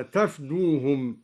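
تفدوهم said "tafduhum", vowelless f; no alef. Shown as a demonstration. Teacher showing the word alone, تفدوهم as "tafdoohum" without alef. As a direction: open the t and close the f in تفدوهم. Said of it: "تفدوهم بفتح التاء وإسكان".

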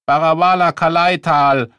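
loud high shout of the name. Acted. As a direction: yell it out. First shout of the phrase.

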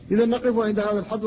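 ordinary delivery

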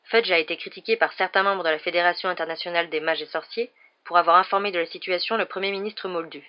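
plain reading voice

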